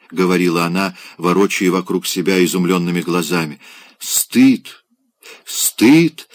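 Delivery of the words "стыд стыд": abrupt, higher cries of «Стыд!», each clipped short; jerky cries of shame, pitch climbing; cried out. A narrator's reading. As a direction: burst out in broken, anguished cries. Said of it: "отрывисто вскрикивала она".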